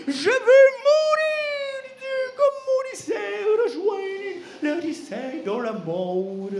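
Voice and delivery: hammed-up singing sob; melodramatic crying. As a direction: moan it like a tragic opera. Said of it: "il a commencé à pleurer".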